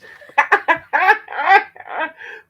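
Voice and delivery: high giggling laugh from being tickled; high ticklish laugh. Real, not acted. Man laughs high while the dog licks his toes.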